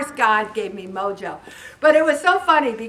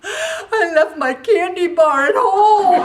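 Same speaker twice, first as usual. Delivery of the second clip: high, slow, laughing imitation of a sob; hammed-up child's wail over candy; mock sobbing child; 8 semitones higher, 7 dB louder, slower than usual. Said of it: "and she's just sobbing".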